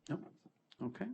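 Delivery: ordinary delivery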